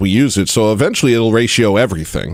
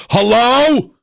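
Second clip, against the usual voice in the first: yelled hello; 13 semitones higher than usual. Retold or imitated. Waking himself up yelling at the demon. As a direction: belt it out into the night. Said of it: "That's how I woke myself up".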